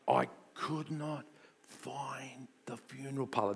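hushed dramatic whisper; breathy whispered confession. Acted. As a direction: drop to a whisper for suspense. Hushed recall of panic.

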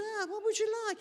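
very high mock-coddling parent; indulgent parent. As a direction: coo it to a little child, hammed-up. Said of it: "But he said".

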